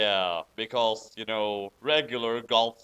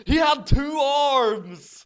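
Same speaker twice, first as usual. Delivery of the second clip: 1 hammed-up shock; 10 semitones higher, 8 dB louder, much slower than usual. Dramatic reading, shocked narrator.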